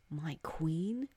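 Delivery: mouthed words. Acted. Marjorie mouthing Hari's words, barely voiced. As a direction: breathe the words, hardly aloud. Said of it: "She mouthed his words".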